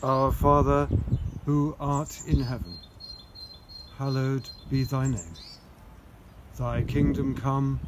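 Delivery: solemn recited prayer. Acted. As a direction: intone it steadily.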